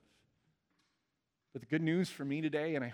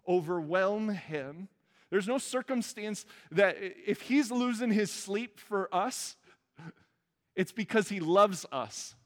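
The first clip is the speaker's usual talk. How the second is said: higher, louder preaching build; impassioned preaching; 4 semitones higher, 4 dB louder than usual. Acted. Preacher assuring the congregation, rising in passion. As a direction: press the point home from the pulpit.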